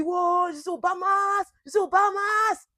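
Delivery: high, loud joyful whoop; mock excited shout. Imitating the boyfriend splashing into the sea, excited whoop. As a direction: whoop like a tourist in paradise.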